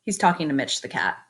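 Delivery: plain reading voice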